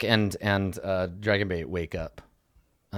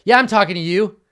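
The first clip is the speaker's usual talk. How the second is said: higher, louder jeering challenge; provoking shout; 10 semitones higher, 8 dB louder, faster than usual. Acted. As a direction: call out the guard to pick a fight. Artis provoking the guard.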